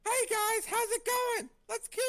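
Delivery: high, loud squeaky gnome; chirpy little gnome greeting. Acted. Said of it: "practicing my gnome voice".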